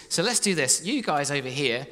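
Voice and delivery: usual speaking voice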